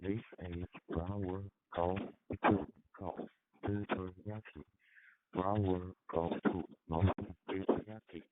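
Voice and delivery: ordinary talk, nothing marked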